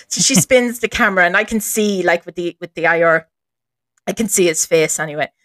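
usual speaking voice

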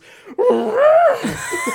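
high shouted trumpeting cry; elephant trumpet. Retold or imitated. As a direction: mimic an elephant blaring. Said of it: "And he just goes".

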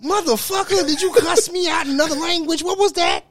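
high, incredulous mock outrage. Real, not acted. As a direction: act baffled and offended, up high.

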